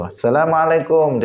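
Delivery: high called-out "Assalamualaikum"; calling at the door. Retold or imitated. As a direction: call it out through a closed door. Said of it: "dia ngetuk-ngetuk pintu".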